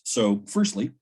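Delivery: ordinary delivery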